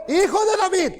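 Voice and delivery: high-pitched mock call; imitated calling out. Canaanite woman claiming a promise not hers, high imitated call. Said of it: "Y le está diciendo".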